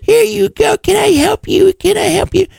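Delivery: very high, babying mock voice; Beth, doting. Beth fussing over Carter, mocked as high and doting. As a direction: coo in a squeaky, hammed-up fawning voice. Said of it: "And now she's like".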